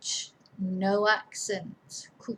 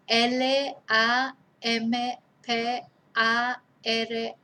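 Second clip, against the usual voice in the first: slow spelling; slower than usual. Shown as a demonstration. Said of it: "spelling out letters".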